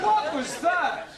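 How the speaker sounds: high, slow and startled; alarmed. Acted. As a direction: jump at the sudden noise. Alarmed at awful noise.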